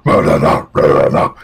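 low, loud guttural "ra" noises; imitated growling track. Mimicking the character's theme track for the co-hosts, growled low. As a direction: growl it out like a metal riff. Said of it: "his track goes".

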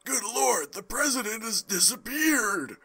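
high breathless whisper; shocked whisper. Acted. Character whispering in shock at the vanished president. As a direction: gasp it out in disbelief.

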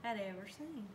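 plain reading voice

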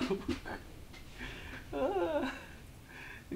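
long airy high giggle; real airy laughter; breathy laugh. Real, not acted. Laughs at God playing.

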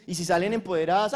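normal talking voice

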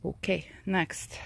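slow whisper to herself. Real, not acted. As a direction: whisper it under your breath. Moving on, to herself.

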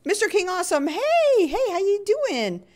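high, loud and bright hello to a viewer; excited greeting. Real, not acted. Streamer welcoming a viewer in chat, high excited greeting.

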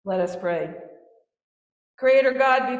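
ordinary talk, nothing marked

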